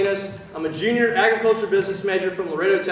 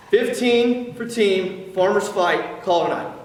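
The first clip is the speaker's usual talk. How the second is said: slow and deliberate, each word spaced; slow pass back. Shown as a demonstration. Cadet slowing down the pass back for his watcher, deliberate. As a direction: spell it out for the learners. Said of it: "Slow down".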